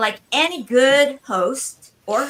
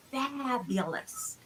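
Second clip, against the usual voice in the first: slow, soft, savoring fabulous; drawn-out fabulous; 10 dB quieter, much slower than usual. Shown as a demonstration. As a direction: stretch out fabulous like a catchphrase. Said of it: "As we say around here".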